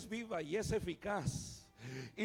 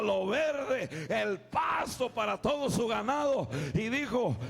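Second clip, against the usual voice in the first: full-force preaching; 6 dB louder than usual. Acted. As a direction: preach it out loud to the whole church.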